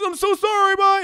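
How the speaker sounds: high, loud hasty apology; mock flustered apology. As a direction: apologise and flee, hammed up. Cyclist apologises and flees.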